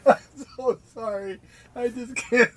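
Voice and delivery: high-pitched, laughing too hard to go on; real giggling breakdown; helpless laughter. Real, not acted. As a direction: apologize through a real laughing fit.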